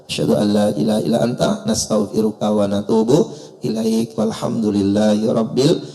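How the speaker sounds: Arabic prayer intoned low; closing prayer. Acted. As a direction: recite the closing prayer reverently.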